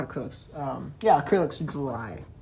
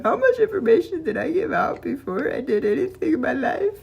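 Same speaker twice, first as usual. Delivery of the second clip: higher, louder exasperated question; mock yourself, higher and louder; exasperated self-quote; 9 semitones higher, 7 dB louder than usual. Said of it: "I'm like".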